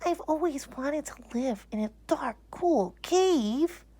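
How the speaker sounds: a puzzled toy bear; small teddy bear voice. Acted. Corduroy the bear realizing he has no pocket.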